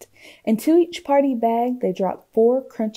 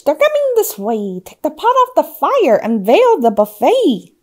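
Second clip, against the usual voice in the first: higher, louder urgent witch voice; witch's cry; 6 semitones higher, 8 dB louder than usual. A narrator's reading. Witch orders her guests to hide the feast, urgent cry. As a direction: hurry everyone along in alarm. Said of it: "cried the witch".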